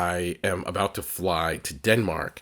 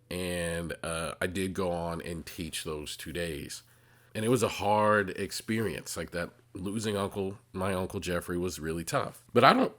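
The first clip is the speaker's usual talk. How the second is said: ordinary talk, nothing marked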